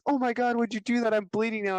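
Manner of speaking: high-pitched mock distress; upset little girl. Headbutted girl questions the boy, high mock distress. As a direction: imitate a hurt girl demanding an explanation.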